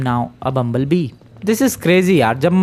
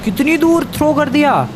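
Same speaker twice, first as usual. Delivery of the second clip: amazed; 11 semitones higher than usual. Real, not acted. Amazed at the throw.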